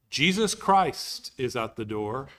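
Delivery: ordinary talk, nothing marked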